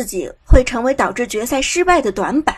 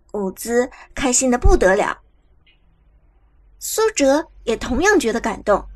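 usual speaking voice

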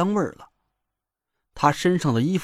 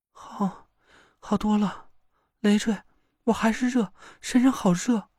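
put-on weak girl; 5 semitones higher than usual. Weak girl after the ritual.

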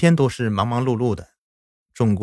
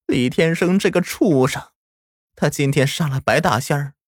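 tearful grandma; 8 semitones higher than usual. Acted. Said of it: "奶奶却流着眼泪说道".

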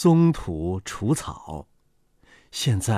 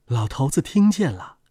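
ordinary delivery, nothing marked

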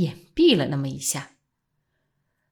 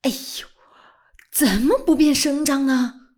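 sudden angry outburst; 7 semitones higher than usual. A narrator's reading. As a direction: flare up suddenly. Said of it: "钱先生的声音忽然提高，像发了怒似的". (忽然提高声音，像发怒)

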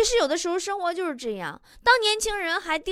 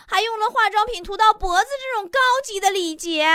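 put-on gushing girl; 8 semitones higher, 6 dB louder than usual. Girl gushing about her crush.

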